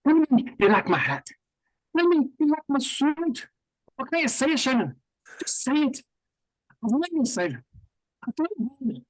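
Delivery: high-pitched, hammed-up attempt at a Scottish-Irish brogue; attempted Scottish accent, Irish-leaning. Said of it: "It's a similar accent".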